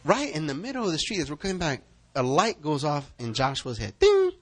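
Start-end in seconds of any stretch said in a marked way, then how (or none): 4.0-4.3 high sharp ding; ping it like a bell; ding sound effect. Retold or imitated. Light going off in his head, high bright ding.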